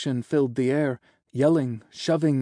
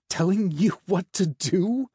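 high, incredulous echo rising to a question; scoffing, amused question; mocking laugh; 6 semitones higher than usual. A narrator's reading. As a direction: throw it back in mocking disbelief. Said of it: "the angel laughed".

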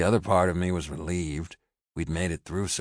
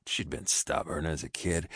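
plain reading voice

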